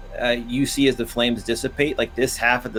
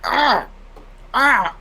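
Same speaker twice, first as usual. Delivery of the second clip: 1 pained cries; 12 semitones higher than usual. Acted. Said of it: "and he's like".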